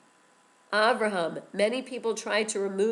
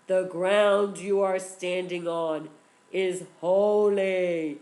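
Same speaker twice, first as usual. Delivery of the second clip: slow, loud, booming voice from the bush; powerful voice; 7 dB louder, much slower than usual. A narrator's reading. God calling to Moshe from the burning bush, slow and booming. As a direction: boom it out slowly like a divine voice. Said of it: "it sounded like a powerful voice".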